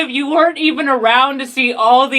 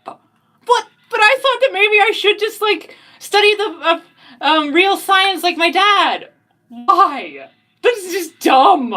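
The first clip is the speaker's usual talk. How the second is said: high character voice; 6 semitones higher than usual. Acted.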